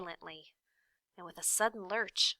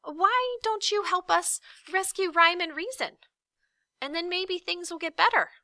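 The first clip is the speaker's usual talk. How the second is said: high, loud plea; desperate shout; 11 semitones higher, 6 dB louder than usual. A narrator's reading. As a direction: shout before he swallows you. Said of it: "shouted Milo again".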